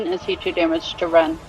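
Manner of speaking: usual speaking voice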